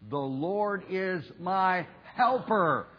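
slow, with "helper" punched high and loud; stress on HELPER. Shown as a demonstration. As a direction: build to a hard, high "helper". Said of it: "emphasis on "helper".

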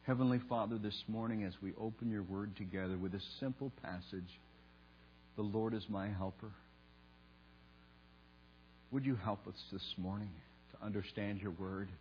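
soft, reverent public prayer. Real, not acted. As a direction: pray quietly, pausing between phrases. Leads a prayer.

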